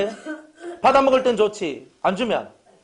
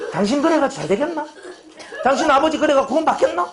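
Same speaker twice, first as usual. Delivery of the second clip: higher, hammed-up scolding rant; mock angry cursing; 7 semitones higher than usual. Spiteful pastor curses the departing deacon, mock angry and higher. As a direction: play the spiteful pastor damning him. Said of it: "이렇게 하면 어떻게 되는 거예요".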